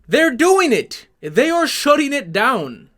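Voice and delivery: incredulous outburst. Real, not acted. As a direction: shout it out as if you can't believe it. Exasperated rant at the company.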